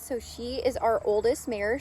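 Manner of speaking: ordinary talk, nothing marked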